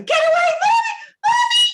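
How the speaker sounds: put-on high, loud blind-terror child's shriek; terrified scream. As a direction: shriek at the werewolf coming closer. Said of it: "Amy screams in blind terror".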